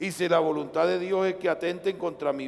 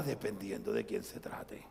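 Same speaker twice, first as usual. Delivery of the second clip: hushed, breathless aside; whispered sermon aside; 9 semitones lower, 12 dB quieter than usual. Real, not acted. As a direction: drop to a whisper for the point.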